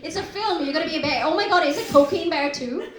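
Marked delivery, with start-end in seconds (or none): none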